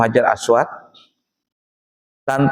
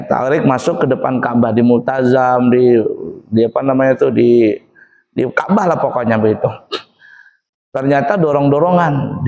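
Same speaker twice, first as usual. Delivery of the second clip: ordinary talk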